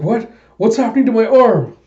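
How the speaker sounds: high, loud, fast cry of alarm; panicked villain. Retold or imitated. Villain as his arm explodes, loud high panic. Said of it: "And he's like".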